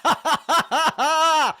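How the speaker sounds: high, loud cackle of real laughter; loud burst of laughter. Real, not acted. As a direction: crack up at the clip, loud and high. Streamer bursts out laughing at clip.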